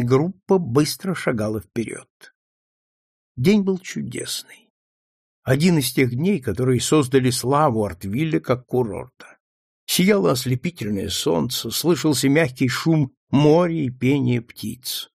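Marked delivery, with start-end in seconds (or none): none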